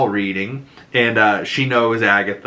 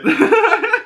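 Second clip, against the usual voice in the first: high squealing laugh; 4 dB louder than usual. Real, not acted. Cracking up after joke.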